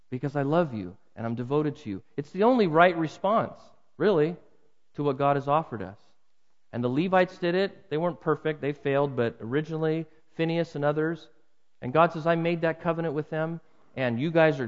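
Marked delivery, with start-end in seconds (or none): none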